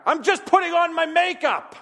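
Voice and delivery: high, loud call back from another room; loud, high mock wife holler. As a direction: shout it cheerfully from the bathroom. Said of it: "she hollers back".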